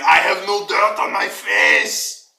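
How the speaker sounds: loud, slow, indignant NPC shout. Acted. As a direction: bellow it back as the offended fighter. NPC Ryan retorts angrily.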